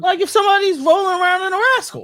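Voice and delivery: high, fast, put-on squeak; squeaky high voice. To her co-host about scooter riders, high squeaky joke voice. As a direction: pipe it up high and quick, teasing.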